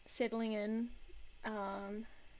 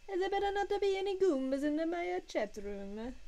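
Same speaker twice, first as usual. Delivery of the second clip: Mario voice; 8 semitones higher, 4 dB louder than usual. Pretend. Mario voice again.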